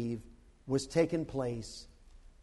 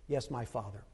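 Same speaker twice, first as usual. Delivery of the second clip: soft, hushed Father and Son exchange; intimate dialogue; 3 semitones lower, 4 dB quieter than usual. Acted. Preacher imagining Jesus talking with the Father, hushed and tender. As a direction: whisper the conversation as sacred.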